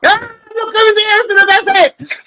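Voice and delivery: very high, loud, slow squeal of delight; giddy falsetto greeting; excited squeal. Real, not acted. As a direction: squeal at the name popping up. Spots a viewer arriving in chat.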